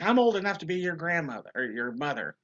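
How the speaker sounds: fast, high, loud imitated scolding; mock irate yell, easing at the end. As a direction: play the offended woman telling him off. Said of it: "like, yelling at him".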